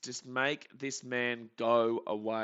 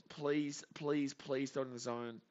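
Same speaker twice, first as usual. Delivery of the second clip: ordinary talk, nothing marked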